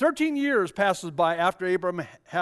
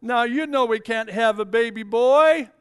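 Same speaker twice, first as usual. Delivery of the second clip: teasing wife; 5 semitones higher, 6 dB louder than usual. Retold or imitated. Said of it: "I can imagine Sarah saying".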